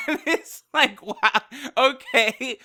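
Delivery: talking through real laughter; words breaking up into amused laughs; laughing. Real, not acted. Host reacting to Champa's funny line, laughing through words.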